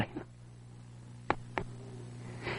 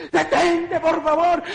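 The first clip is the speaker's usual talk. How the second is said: crying plea; 12 semitones higher, slower than usual. Retold or imitated. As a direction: plead through tears. Begging during the ordeal. (súplica llorosa y aguda)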